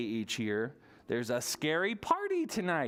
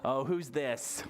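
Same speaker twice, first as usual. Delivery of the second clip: normal talking voice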